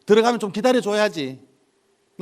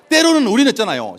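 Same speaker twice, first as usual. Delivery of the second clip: ordinary talk, nothing marked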